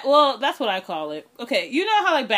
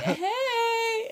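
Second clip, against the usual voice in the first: squeaky imitating wave hello; high mock greeting; 14 semitones higher, 4 dB quieter than usual. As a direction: chirp it like a silly wave. Cop waving, imitated greeting.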